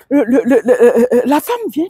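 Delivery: ordinary talk, nothing marked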